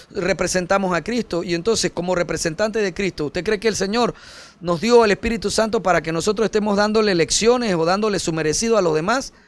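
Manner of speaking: ordinary talk, nothing marked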